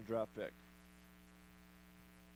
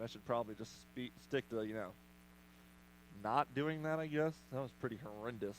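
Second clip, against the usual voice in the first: ordinary talk, nothing marked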